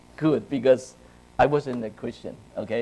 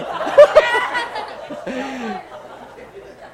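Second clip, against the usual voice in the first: long, high falling laugh; 13 semitones higher, 5 dB louder than usual. Real, not acted. Preacher laughing with the youth after the handshake.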